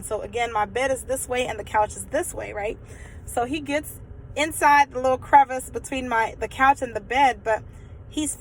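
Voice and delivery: plain reading voice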